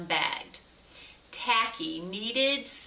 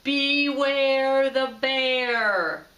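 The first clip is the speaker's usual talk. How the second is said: very slow, loud, growled boom; a real bear booming out at midnight; booming growly bear; 3 semitones higher, 6 dB louder, much slower than usual. A narrator's reading. The bear waking the penguins, booming slow and loud. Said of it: "a great growly voice boomed out".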